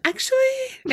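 squeaked high-pitched question; high actually. Real, not acted. Host laughing at her own word, squeaked high. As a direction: let the word shoot way up high. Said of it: "I don't know why I had to go up so high".

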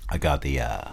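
low, soft McConaughey impression; Matthew McConaughey.

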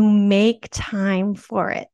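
slow, with "make" pressed hard and drawn out; stress on make. Shown as a demonstration. To viewers who wait for free time, stressing "make" slowly. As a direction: lean heavily on "make", then "time for it" at an even pace. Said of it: "I emphasize the word make".

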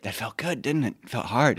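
soft, solemn youth pastor; real quiet. Retold or imitated. Youth pastor after the teens posted sins, hushed. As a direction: drop to a hushed, earnest check-in. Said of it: "he got real quiet".

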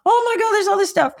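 high exasperated cry; mock panic; overwhelmed. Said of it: "I'm like".

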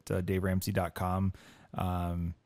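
ordinary talk, nothing marked

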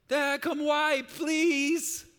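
put-on high, loud, drawn-out little-kid yell; stretched-out, high-pitched toddler plea; small child calling out. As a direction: holler for dad like a three-year-old. Voices small child calling for dad.

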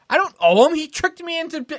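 high-pitched defensive outburst; retold aggrieved denial; indignant protest. Protesting to the second carny.

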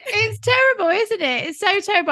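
said through laughter; laughing. Real, not acted. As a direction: giggle it out. Laughing at shared awkwardness.

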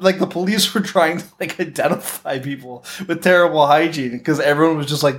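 normal talking voice

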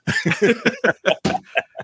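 a bright, high laugh; high amused laugh. Real, not acted. Movie fan laughing about loving it already. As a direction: laugh at your own hype.

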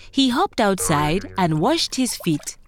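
plain reading voice

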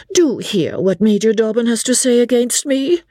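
high, sweetly pleading voice; angelic sweetness. A narrator's reading. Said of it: "with angelic sweetness".

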